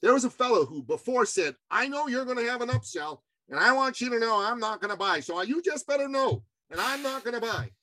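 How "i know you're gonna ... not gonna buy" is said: higher-pitched imitation of a stubborn customer; imitating a buyer digging in; mock defiant insistence. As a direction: lay down the law in a raised, put-on pitch.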